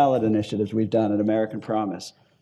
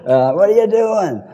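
a mock Maine accent, pitched up and louder; 8 semitones higher, 5 dB louder than usual. Maine voter at the farmers market asks what he's doing, in a Maine accent. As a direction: imitate the Maine voter's Maine accent, high and eager. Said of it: "I'm not making fun of that accent".

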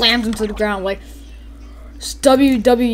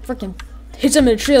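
ordinary talk, nothing marked

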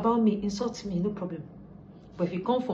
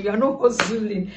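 normal talking voice

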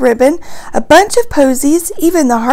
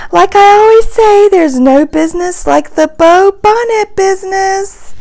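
high, louder character voice; giggly Minnie; 6 semitones higher, 5 dB louder than usual. A narrator's reading. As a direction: pitch it up, giggly and pleased. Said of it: "Minnie giggles".

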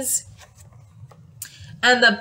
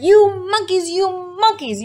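angry shout; 8 semitones higher than usual. Acted. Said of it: "really very angry".

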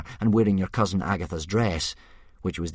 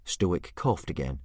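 plain reading voice

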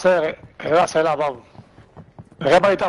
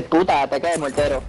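lower, teasing imitation of a camp voice; mocking effeminate voice; 6 semitones lower than usual. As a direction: mimic the effeminate announcer mockingly.

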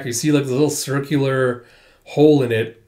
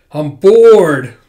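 loud whine; 3 semitones higher, 11 dB louder than usual. Retold or imitated. Whining character quote.

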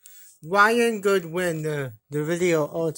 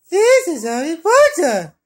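high, loud grand announcing cry; excited announcement; 9 semitones higher, 10 dB louder than usual. Pretend. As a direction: proclaim it like a showman. Announcing characters in play.

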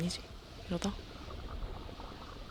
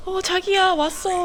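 relieved; 11 semitones higher, 6 dB louder, slower than usual. Real, not acted. Partner diver arrives.

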